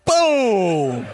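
impact sound. Retold or imitated. Dodgeball hits her.

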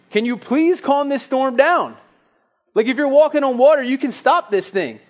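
high, loud, frantic begging; mock-panicked plea to Jesus from a sinking boat; panicked pleading. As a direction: beg Jesus to fix the storm, voice climbing. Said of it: "here's what I would have said".

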